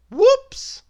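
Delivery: high, loud squeal of whoops; squeaky whoops. A narrator's reading. Narrator squeals when eggs drop. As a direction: squeal it like a sudden slip. Sound-effect exclamation after accident.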